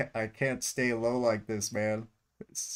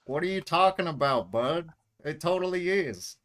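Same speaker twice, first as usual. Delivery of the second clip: higher-pitched mock Texas accent; 6 semitones higher than usual. Shown as a demonstration. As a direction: protest in a put-on Texas accent. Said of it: "That's not a Texas accent".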